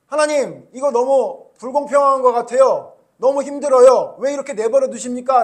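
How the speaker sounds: pleading loudly, as if worn out; complaining to God. Acted. Preacher voices Jeremiah complaining. (하나님께 크게 하소연하듯)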